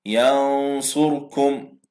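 drawn-out ينصركم with held ghunna; nasal ikhfa demo. Shown as a demonstration. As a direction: recite ينصركم slowly with the nun in the nose. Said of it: "نون ساكنة جاء بعدها حرف الصاد".

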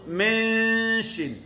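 teacher demonstrating ikhfa; ikhfa min. Shown as a demonstration. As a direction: hold the nasal hum on Min before shin. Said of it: "Cuba sebut".

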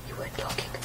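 whispering as the viewer; whispered viewer. Retold or imitated. To the audience, mimicking a viewer's remark in a whisper. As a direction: breathe it, voiceless, playing the viewer. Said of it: "I bet you're like".

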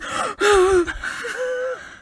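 high, drawn-out mock gasp; shocked gasp. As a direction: gasp as if the trumpets just sounded. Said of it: "I would just be".

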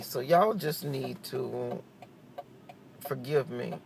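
usual speaking voice

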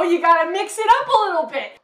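ordinary talk

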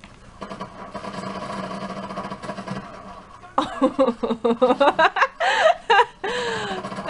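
long, high cackling laugh; helpless laughter. Real, not acted. Player laughing at the worst way to kill someone, helpless cackle.

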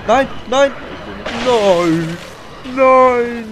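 desperate high yell for a fallen comrade; man down shout. Real, not acted. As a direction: scream his name, begging him not to die. Teammate Cole down in game. (verzweifelter Schrei um den gefallenen Kameraden)

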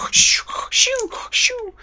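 high, airy mock-snore whistles; exaggerated cartoon sleeping breaths, "shoo" on each exhale; sleeping impression. Gamer acting out bedtime for viewers, pretend sleeping noises. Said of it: "that's my awesome sleeping impression".

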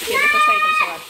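high, loud delighted exclamation; excited call. Real, not acted. Mother shows viewers her daughter on the cycle, high and delighted. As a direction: cheer on the little cyclist. Showing child on her bicycle.

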